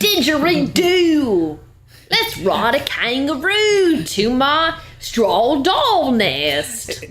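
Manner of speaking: slow, high, hammed-up Australian; mock Australian accent. Clowning about the Butcher's accent, mock Australian. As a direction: drawl it in a silly Australian accent. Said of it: "that's very Australian".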